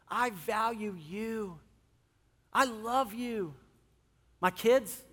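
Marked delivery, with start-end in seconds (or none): 0.1-3.5 tender reassurance. Retold or imitated. Tender response to wife.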